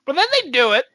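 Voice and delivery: normal talking voice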